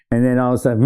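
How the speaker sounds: usual speaking voice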